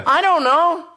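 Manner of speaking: put-on high, confused little kid; a child in the playground, clueless. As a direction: shrug it off like a child. Child answers in the scene.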